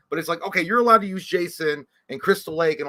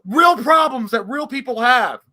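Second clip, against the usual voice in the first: hammed-up indignation; 9 semitones higher, 7 dB louder than usual. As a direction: play the wronged partner. Mock lovers' quarrel.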